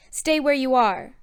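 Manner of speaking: loud, firm command. A narrator's reading. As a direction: order them to halt. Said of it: "Rivrin commanded".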